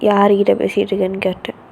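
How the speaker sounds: low, gruff demand from a big brother; a brother barging in, suspicious; stern older brother. Acted. Brother storming into sister's room, low and stern. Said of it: "உங்க அண்ணன் ... வரான்".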